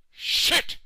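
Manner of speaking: sudden loud outburst of panic; shouted curse. Acted. Stuck president cursing in panic, shouted. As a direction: let the swear explode.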